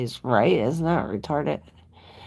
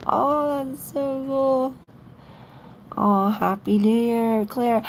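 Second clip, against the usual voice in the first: gushing greeting; 11 semitones higher, slower than usual. Real, not acted. Greeting viewer Claire excitedly.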